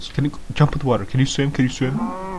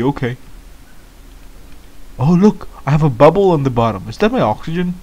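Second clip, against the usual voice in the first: ordinary talk, nothing marked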